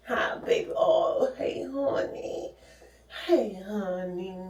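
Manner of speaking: soft whispered hello to the pet; whispering hi to the dog; whispered greeting. Real, not acted. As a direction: breathe a tender hello.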